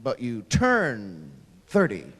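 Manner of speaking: low, slow stretched "turn"; deflated letdown. Shown as a demonstration. Comic contrasting thirty with twenty-one, deflated. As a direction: let it sink like bad news.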